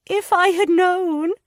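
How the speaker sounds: high, anguished cry; a distraught, high outburst; cried out. A narrator's reading. Lydia crying out in distress to Anne. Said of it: "she cried".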